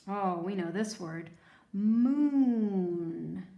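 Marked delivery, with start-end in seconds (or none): 1.7-3.5 "Moon" held long and loud on the oo; stretched long oo. Shown as a demonstration. Teacher stretching moon for the children. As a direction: draw out the "oo" in "Moon". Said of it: "the double O is saying ooh".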